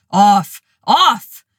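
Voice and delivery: loud barked commands at dogs; booming command. A narrator's reading. Narrator shouting at the pit bulls, booming commands. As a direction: shout them down like a dog owner. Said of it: "booming commands directed at the pit bulls".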